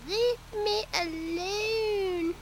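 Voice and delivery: high, very slow grumpy cat voice; voicing the cat. Owner voicing her camera-shy cat, high and very slowly. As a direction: drag it out like a sulky cat.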